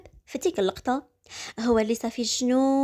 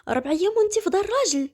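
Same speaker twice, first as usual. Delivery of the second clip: angry outburst; 6 semitones higher, faster than usual. Acted. Husband furious at wife.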